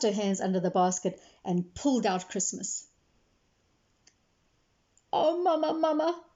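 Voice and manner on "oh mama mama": lightly voiced pleading girl, higher; pleading child. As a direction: beg mama to save the hen. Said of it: "cried Jamila".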